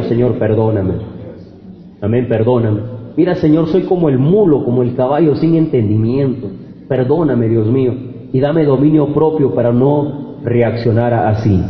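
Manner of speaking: a hushed plea for forgiveness; humble prayer. Acted. Voices a prayer of repentance.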